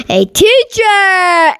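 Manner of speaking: whole class sings out slowly, pitched well up; slow high chorus. Acted. The class answering Mister Slinger, slow and high. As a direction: draw it out like a room of kids.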